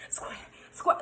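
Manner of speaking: urgent scared whisper; man in a panic hushing his guest, whispering. Retold or imitated. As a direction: hiss it under your breath, terrified.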